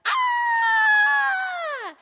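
very high, loud shriek; ex-boyfriend screaming in shock; startled scream. Retold or imitated. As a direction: shriek like he was just hit with hot tea. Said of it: "he was all like".

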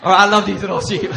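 loud, laughing hammed-up call about the sheep; mock shouted instruction. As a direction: yell it like a joking shepherd. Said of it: "He's like".

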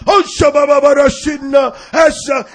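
high, loud revival shouting; hammed-up hallelujah preacher in full cry; mock faith healer. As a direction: parody a showy healing prayer. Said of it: "Didn't make a like".

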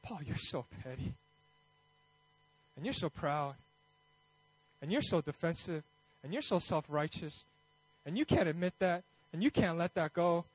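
quiet, low voice of God correcting; God's gentle rebuke. Acted. Preacher voices God confronting him over his pride, quiet rebuke. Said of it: "And God's just like".

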